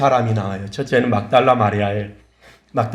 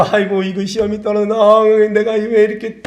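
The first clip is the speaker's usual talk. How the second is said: mock wailing; 7 semitones higher than usual. Someone who failed an exam laments, mock wailing. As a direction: imitate someone wailing over a failure. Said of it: "통곡하고 있는가".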